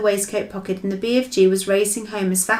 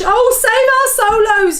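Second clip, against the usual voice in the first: high, loud, slow anguished wail; fury and anguish; 14 semitones higher, 8 dB louder than usual. Acted. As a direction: groan to heaven in despair. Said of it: "His voice was filled with fury and anguish".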